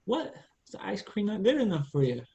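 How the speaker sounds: a hint of a sassy New Orleans lady; nosy stranger. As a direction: playfully call out the stranger. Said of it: "she says".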